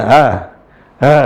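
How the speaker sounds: ha softened halfway between a glottal a and h; in-between ha sound; between hamzah and ha. Shown as a demonstration. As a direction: breathe a half-hamza ha. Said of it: "Gimana cara bacanya".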